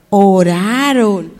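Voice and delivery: slow, stretched ORARON; drawn-out prompt. Shown as a demonstration. Teacher gives the answer to repeat, drawn-out. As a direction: say ORARON slowly for them to echo.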